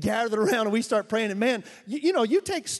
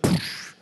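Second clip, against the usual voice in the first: spray noise. Retold or imitated. Imitating an evaporator spray.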